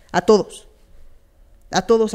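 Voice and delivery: a todos punched out loud and firm; a todos, declared loudly to the whole room; emphatic. Real, not acted. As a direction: hit a todos hard and loud. Repeats "a todos" louder then whispered.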